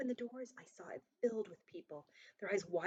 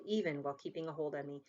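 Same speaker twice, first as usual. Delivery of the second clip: ordinary delivery, nothing marked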